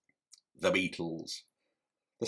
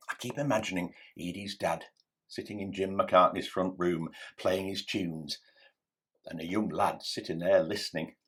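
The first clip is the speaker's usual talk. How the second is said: ordinary talk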